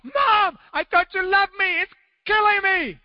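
put-on high wailing little boy crying out in pain; little boy howling at his mother over a stinging wound, high and wailing; screaming child. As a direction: wail it like a hurt kid betrayed by mom. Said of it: "he's screaming even more".

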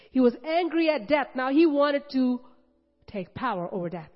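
preacher rising in force; fervent preaching. Real, not acted. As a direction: stir the crowd with passion.

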